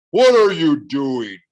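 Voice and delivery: loud, slow monster demand; hulking cyclops bellowing at the door. A narrator's reading. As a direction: boom it out like a giant. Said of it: "the monster demanded".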